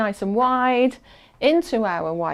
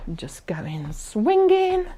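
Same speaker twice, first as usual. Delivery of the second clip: breathless whisper; slower than usual. Real, not acted. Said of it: "but I'm not fast".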